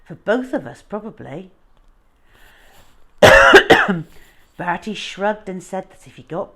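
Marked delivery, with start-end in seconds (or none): 2.8-4.2 sudden loud cough. Real, not acted. Reader coughing sharply during the story. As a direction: cough hard, breaking off.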